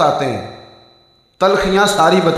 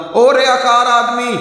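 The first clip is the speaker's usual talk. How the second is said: higher, louder, slow jeering call from behind; calling out insult; 7 semitones higher, 4 dB louder than usual. Retold or imitated. Man shouting after Hasan Basri from behind, a loud slow taunt. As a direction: yell it after him like a taunt. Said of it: "پیچھے سے آواز دی".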